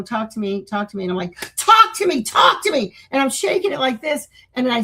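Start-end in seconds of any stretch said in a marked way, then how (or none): 1.6-2.9 raised, higher demanding yell; retold screaming. Retelling screaming at the demon box, raised yell. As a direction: yell it at a box, mock out of control. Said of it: "screaming at the box".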